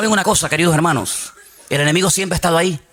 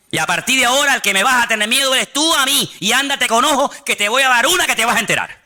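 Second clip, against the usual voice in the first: defiant threat; 6 semitones higher than usual. Acted. Defying the devil.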